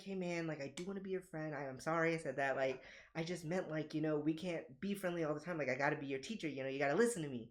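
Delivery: put-on calmly smooth it over with a child; gentle apology. Teacher apologises gently to crying kid.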